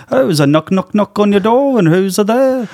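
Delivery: high, loud sing-song [name] voice; Dana Carvey Paul impression. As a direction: bounce it up like a chirpy Beatle. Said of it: "Dana Carvey's impression of him".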